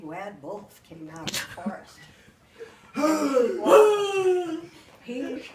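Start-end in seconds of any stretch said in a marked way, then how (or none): 3.0-5.4 very slow words squeezed out through laughter, high and loud; real helpless laughter; laughing. Real, not acted. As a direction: laugh so hard the words barely come. Teller breaks up laughing.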